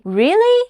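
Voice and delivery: "Really?" rising high at the end; surprised upward "Really?". Shown as a demonstration. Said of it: "when you are surprised and say".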